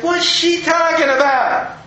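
slow, high-pitched puzzlement; baffled. Retold or imitated. Pastor puzzles over her words for a month, baffled. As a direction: draw out the retold disbelief, voice way up.

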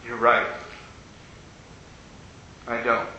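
low, broken confession, head hung; a tough man quietly admitting he is beaten; tearful admission. Retold or imitated. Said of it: "tears started to roll down his eyes".

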